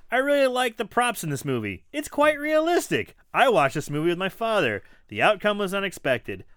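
high, loud, fast mock reading; reading a review aloud. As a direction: read it like an eager fan. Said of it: "Chapman Whitman".

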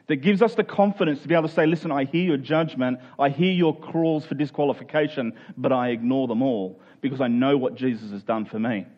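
ordinary delivery

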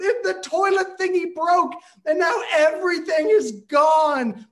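high, loud, frantic shouting; panicked screaming. Retold or imitated. Son on phone explains flood, frantic high shout. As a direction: keep screaming, barely making sense. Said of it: "I just started screaming".

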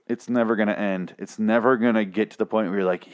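normal talking voice

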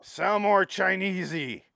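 mock director yelling from off screen; high, drawn-out call; Brett Ratner. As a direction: call it out slowly, hammed up. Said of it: "I can just picture Brett Ratner going".

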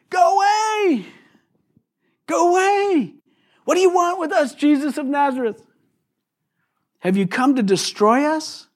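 very high-pitched mock yell, held back in volume; high, strained cry of a possessed man; demon crying out. Preacher voices the demon confronting Jesus, crying out. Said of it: "He cried out at the top of his voice".

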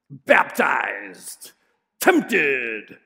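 low, loud growled words like a metal singer. Acted. Preacher joking the gospel sounds metal, growling. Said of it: "Mark's gospel would sound metal".

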